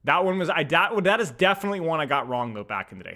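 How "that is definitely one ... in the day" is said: usual speaking voice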